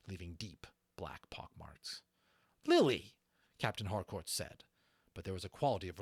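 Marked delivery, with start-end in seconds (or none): 2.7-3.2 loud, high call; exclaiming. A narrator's reading. Harcourt calling out to Lily, loud. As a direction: call out the dragon's name.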